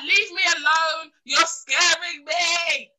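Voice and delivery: high, drawn-out mock plea; hammed-up scared wail, stretching the last words; dramatic protest. Woman telling a pestering man to back off, dramatic.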